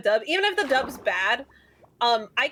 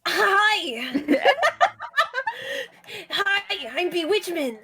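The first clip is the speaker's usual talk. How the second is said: higher, hammed-up anime boy hero; anime protagonist voice; 8 semitones higher, slower than usual. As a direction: play the teenage anime lead. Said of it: "anime boy pro tag".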